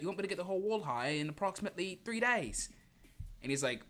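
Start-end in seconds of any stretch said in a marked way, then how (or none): 0.0-2.7 mock British accent. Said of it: "Starline's like".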